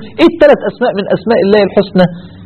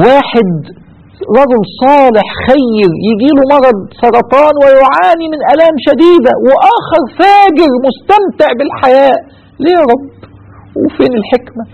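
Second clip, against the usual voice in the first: impassioned lament; 7 semitones higher than usual. Acted. Preacher laments life's injustices.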